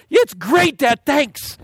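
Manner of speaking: put-on high, happy childish thanks with a laugh; a small girl suddenly all better; cheerful little girl. Daughter answers father.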